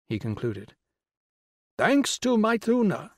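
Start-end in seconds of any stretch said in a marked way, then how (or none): 1.8-3.1 high, slow, triumphant woman's voice; lilting, high-pitched triumph. A narrator's reading. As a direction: proclaim it high and drawn out. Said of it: "said Missus Rao triumphantly".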